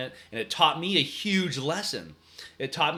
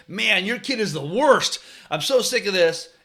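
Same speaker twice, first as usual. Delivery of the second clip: higher, louder exasperated complaint; blaming; 6 semitones higher, 6 dB louder than usual. Shown as a demonstration. Coach confronting the kid's parent, fed-up blaming. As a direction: demonstrate blasting a parent with blame. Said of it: "versus a blaming approach".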